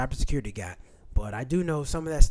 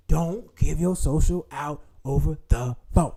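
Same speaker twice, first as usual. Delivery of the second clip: slow, one word at a time; emphatic warning; slower than usual. Real, not acted. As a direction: space out every word to drive it home. Spells out his key warning.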